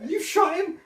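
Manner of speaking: very high, laughing disbelief; shocked squeal. Retold or imitated. Murtaugh shocked that Riggs shot him, high and laughing. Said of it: "he's like".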